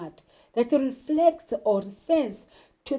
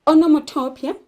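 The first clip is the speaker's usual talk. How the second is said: normal talking voice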